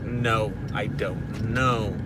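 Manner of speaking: low, quiet, restrained curtness; short, curt. Shown as a demonstration. Parent refusing son like dad did, quietly short. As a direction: drop the voice and hold the anger in. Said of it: "I do get very short".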